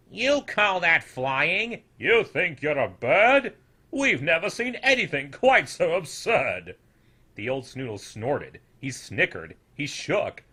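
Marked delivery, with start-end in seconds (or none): 0.1-6.8 slow, loud scornful elder voice; old snoodles jeering at his flight; mocking old snoodles. A narrator's reading. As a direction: sneer down at the youngster.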